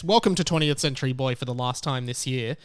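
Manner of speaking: normal talking voice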